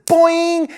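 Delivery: high, loud boing; spring it out like a cartoon boing; boing sound effect. A narrator's reading. Gum snaps away.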